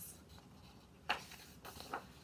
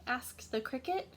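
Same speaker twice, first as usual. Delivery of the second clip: plain reading voice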